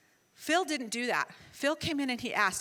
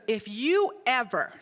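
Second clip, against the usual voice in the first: slow, louder stern warning; really serious; 5 dB louder, slower than usual. Retold or imitated. Son warning his mother, slow and stern. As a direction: lay down the law, word by word. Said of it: "really serious".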